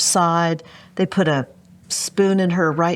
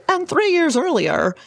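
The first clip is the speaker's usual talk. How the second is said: lightly hammed-up trailer narrator, pitched higher; a hint of a dramatic film narrator; movie trailer voice; 11 semitones higher than usual. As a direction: toss off a mock movie-intro line. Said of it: "like they say".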